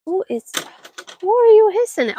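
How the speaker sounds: high, loud call. Real, not acted. Asking the hissing cats who they hiss at, called out high and loud. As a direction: shout the question across the yard.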